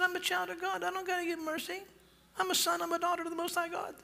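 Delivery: high, fast prim girlish voice mocking her; mocking entitled girl. Preacher mocking the entitled girl's high prim voice. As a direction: mimic her smug, superior tone.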